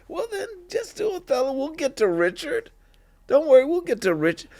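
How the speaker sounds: imitated teachers in a very high, quick voice; high-pitched mock placating; coaxing teachers. Acts teachers coaxing him into Othello.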